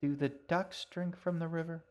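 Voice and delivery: lightly voiced curious young girl; a hint of a wondering child. A narrator's reading. G, the girl, asks.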